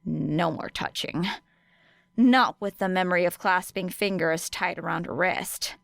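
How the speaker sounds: plain reading voice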